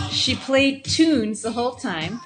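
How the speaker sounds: usual speaking voice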